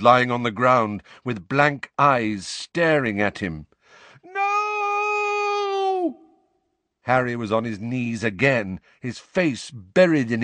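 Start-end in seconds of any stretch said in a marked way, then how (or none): 4.2-6.2 high anguished shout; anguished cry. Acted. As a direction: scream out in anguish. Harry cries out in vision.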